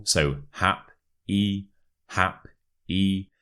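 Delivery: "hap-y" slowly split, weight on "hap"; stress on first syllable. Shown as a demonstration. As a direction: punch "hap", then a separate light "y". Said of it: "the stress is on the first syllable".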